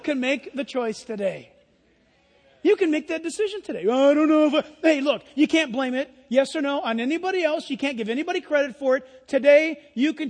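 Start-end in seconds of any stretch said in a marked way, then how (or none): 3.9-6.1 loud, put-on waffler's voice; mock indecision. Preacher mimics someone avoiding today's decision, mock waffling. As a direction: mimic a ditherer, louder than your own voice.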